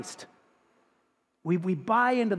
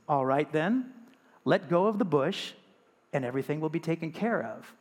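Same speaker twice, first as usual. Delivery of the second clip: a lightly lowered, calm voice from above; calm deep voice; 3 semitones lower, 3 dB quieter than usual. Acted. Voice from the sky answering the hanging man, calm and a touch lower. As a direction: answer steady and unhurried, a hint of God. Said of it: "this calm, deep voice".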